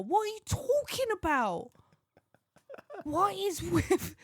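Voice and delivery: high-pitched, incredulous outburst; exasperated. Real, not acted. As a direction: protest in high-pitched disbelief. Exasperated at absurd cat story.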